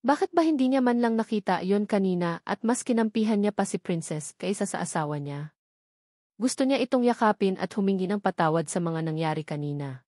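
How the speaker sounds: ordinary delivery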